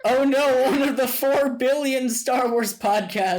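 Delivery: high-pitched sarcastic lament; exaggerated fake alarm; mock dismay. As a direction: play up the horror, voice pitched high. Mock dismay at podcast idea.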